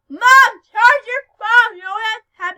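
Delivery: loud call across the house; yelling to mom. Real, not acted. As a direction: shout it out to mom in the next room.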